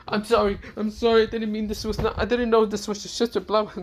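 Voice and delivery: high, rushed apology; frantic apology. Acted. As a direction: grovel in a panic. Sanji sees Sona and Tsubaki.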